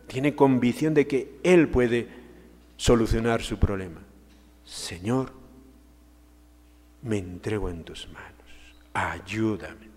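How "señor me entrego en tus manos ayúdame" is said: softer, lower, almost whispered plea; hushed prayer. Acted. Kneeling mother pleads with Jesus, hushed and soft. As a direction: breathe it like a kneeling mother begging.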